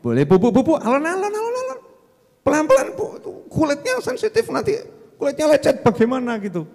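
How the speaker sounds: high, fretting husband pleading alon alon; fussy anxious husband. Retold or imitated. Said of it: "Suaminya ngomong".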